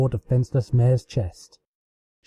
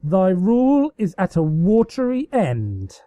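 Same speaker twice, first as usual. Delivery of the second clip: raised, declaiming taunt; villain taunt; 7 semitones higher than usual. Acted. Luna taunting her sister with mock menace. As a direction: proclaim her doom grandly.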